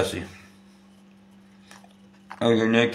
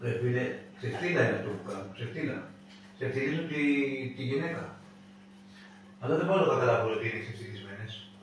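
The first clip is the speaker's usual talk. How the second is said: muttered aside; 14 dB quieter than usual. Real, not acted.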